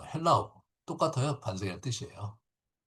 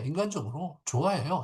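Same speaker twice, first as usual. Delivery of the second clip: ordinary talk, nothing marked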